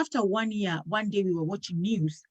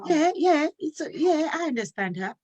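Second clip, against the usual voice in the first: offhand, breezy, higher voice; casual tone; 5 semitones higher than usual. Retold or imitated. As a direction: toss it off like nothing. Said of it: "she dropped it so casually".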